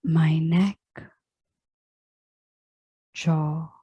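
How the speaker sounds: very slow meditation voice with long pauses; unhurried body scan, each phrase left to settle; guided relaxation. Real, not acted. Yoga teacher guiding listeners into final relaxation, slow meditative delivery.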